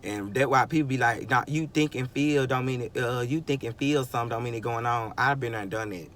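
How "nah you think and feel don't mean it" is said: ordinary delivery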